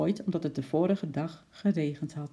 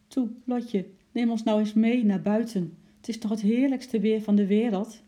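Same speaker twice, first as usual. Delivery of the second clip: higher, louder coaxing plea; lightly voiced pleading chimp; 6 semitones higher, 5 dB louder than usual. A narrator's reading. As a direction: coax them, pitched up. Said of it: "Dat was zijn manier om te zeggen".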